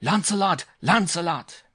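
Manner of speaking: put-on high, loud cheer in a French accent; excited French yell. A narrator's reading. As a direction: yell the horse home like a Frenchman.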